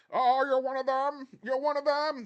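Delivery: very high, loud mock panic; Hans Gruber as Bill Clay. Villain posing as hostage to the hero, mock panic. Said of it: "pretending to be Bill Clay".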